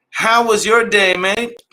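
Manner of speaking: drawn-out friendly mock voice; mock chummy greeting. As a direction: play a cheery mate checking in, slow. Asking himself a viewer-style question.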